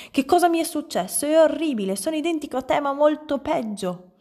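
high-pitched, appalled outburst; horrified dismay. A narrator's reading. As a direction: wail at the sight of yourself. Man horrified at his reflection. (sgomento, con voce acuta)

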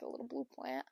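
ordinary delivery

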